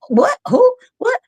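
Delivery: baffled friends. Retold or imitated. Friends' baffled reaction quoted.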